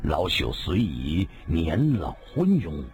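put-on low, obliging old storyteller; ingratiating old man. A narrator's reading. Old storyteller reassures Madam Weng about his memory, ingratiating. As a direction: start slowly, humble and placating, in a low voice. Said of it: "急忙陪笑说道".

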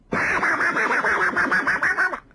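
fast high rattling clack of blades; imitated rapid sword clashes; clashing blows. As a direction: rattle off the strikes like a drum.

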